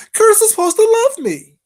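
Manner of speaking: high loud mimicking squeal, stress on Curtis; sneering high imitation, Curtis hit hardest; mocking imitation. Repeating the mock louder.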